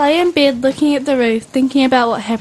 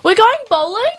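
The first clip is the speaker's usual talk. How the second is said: high-pitched, eager surprised question; 9 semitones higher than usual. Acted. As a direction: react to a happy surprise. Acted surprise at the secret outing.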